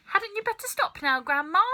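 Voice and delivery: put-on boy begging grandma to stop growing; worried boy. A narrator's reading. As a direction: plead with her to stop. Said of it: "George said".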